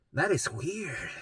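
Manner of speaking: 1 mom's plain take. Retold or imitated. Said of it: "she's like".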